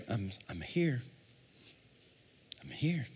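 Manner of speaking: a hushed gentle call; God speaking softly. Retold or imitated. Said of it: "God's gonna say".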